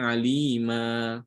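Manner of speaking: alima said slowly, ending on a long open a; demo pronunciation. Shown as a demonstration. Teacher shows students how to stop on alima. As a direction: alima with the final n dropped at the pause. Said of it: "Kalau kita mau berhenti di situ, maka dibaca".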